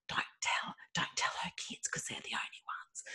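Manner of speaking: soft unvoiced conspiratorial whisper; children told to keep a party secret, whispered; secretive whisper. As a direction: whisper it like a secret kept from one family. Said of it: "but, like".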